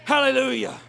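ordinary delivery

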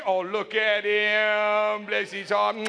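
slow, low, doting coo; cooing to a baby. Pretend. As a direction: melt over a sweet baby. Mother cooing over a baby.

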